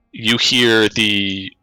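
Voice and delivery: ordinary talk, nothing marked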